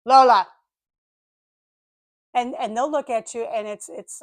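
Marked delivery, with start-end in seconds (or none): 0.0-0.5 loud, short real call; raised-voice call to a pet; calling to dog. Real, not acted. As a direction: interrupt yourself to check the dog.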